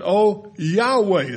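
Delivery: "O Yahweh" drawn out very slowly, with the weight on YAH-weh; slow down and lift the name Yahweh; emphasized Yahweh. Shown as a demonstration. Pastor stresses the personal name of God for the congregation. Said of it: "it's in all capital letters".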